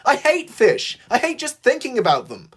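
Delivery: high, loud disgusted rant; exasperated yelling. A narrator's reading. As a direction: cry it out high and fed up. Thomas still yelling about fish.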